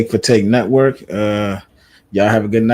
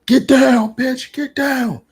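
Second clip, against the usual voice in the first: mock yell, pitched very high; high, strained retold yell at someone; imitated yelling; 14 semitones higher, much slower than usual. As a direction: yell the order in a high-pitched voice, not at full volume. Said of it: "like, yelling at her".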